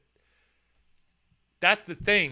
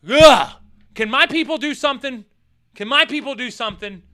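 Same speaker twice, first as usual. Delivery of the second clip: exasperated; 7 semitones higher, 11 dB louder than usual. Retold or imitated. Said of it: "And I'm just like".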